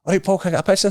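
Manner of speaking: light impression of a fan asking for a photo; mock fan voice. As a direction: pitch it up like an overkeen fan.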